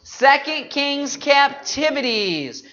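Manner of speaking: group recitation. Shown as a demonstration. Teacher leading the congregation in the next books, slow group recitation. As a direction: stretch each book and key word. Leads the memory list.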